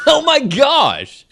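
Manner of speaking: high, loud, drawn-out shocked gasp of words. Real, not acted. Friend hears about the bleeding injury, shocked. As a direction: stretch it out, aghast.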